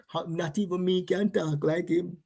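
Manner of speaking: ordinary delivery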